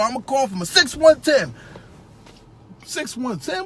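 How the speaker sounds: a hint of a chatty hiring manager laying out the rules; lightly voiced interviewer. Interviewer briefing a new technician, lightly voiced and chatty. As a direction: play the boss rambling through the shift times.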